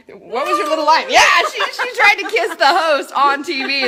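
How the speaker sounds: high, loud laughing telling; words breaking into laughter. Real, not acted. Telling the story of the kiss, laughing hard. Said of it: "laughing about the kiss".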